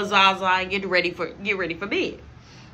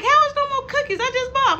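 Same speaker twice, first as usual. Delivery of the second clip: much higher, louder mock mom; mom's shrill disbelief; 5 dB louder than usual. Mom upset about the cookies, high and loud. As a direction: play mom's outraged surprise. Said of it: "She like".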